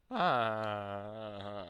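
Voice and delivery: loud, drawn-out falling "aah"; long "aah" of realization. Retold or imitated. Man hearing he looks luminous, gratified "aah". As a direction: let a long knowing "aah" slide down. Imagined delighted reaction to compliment.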